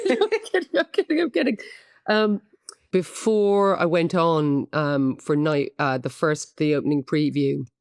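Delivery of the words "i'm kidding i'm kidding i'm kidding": laughing. Real, not acted. Laughs off her joke.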